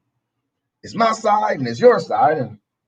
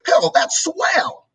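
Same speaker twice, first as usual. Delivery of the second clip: breathy, drawn-out sarcastic sweetness, much higher and very slow; mock sales pitch; 7 semitones higher, much slower than usual. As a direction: oversell it like a slick pitchman. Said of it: "presented in a way to where it seems like".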